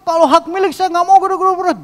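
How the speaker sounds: very high, loud angry yelling; hammed-up furious client, shrieked; imitated rampage. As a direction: explode in a high-pitched mock rage. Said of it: "Ngamuk dia".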